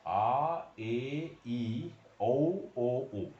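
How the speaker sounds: "ए", "ई", "औ", "ओ", "उ" each said clearly and apart; vowel sounds demo. Shown as a demonstration. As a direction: model each vowel one by one for the class. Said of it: "ये इसका आवाज है". (स्वरों का साफ़ उच्चारण)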